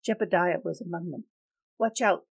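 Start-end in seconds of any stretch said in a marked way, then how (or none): 1.8-2.3 loud, higher warning call; warning shout. Acted. A man warning the mob about Tanchi, loud and urgent.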